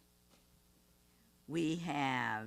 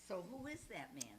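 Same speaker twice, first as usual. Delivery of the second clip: ordinary delivery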